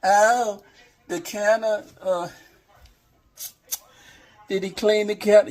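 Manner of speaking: high, loud mocking whine of a picky viewer; nagging viewer. Cook mocks viewers who'll ask about the counter, high and loud. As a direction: mimic a fussy viewer nitpicking the counter. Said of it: "I don't wanna hear y'all lips".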